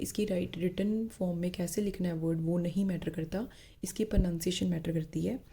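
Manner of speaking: ordinary talk, nothing marked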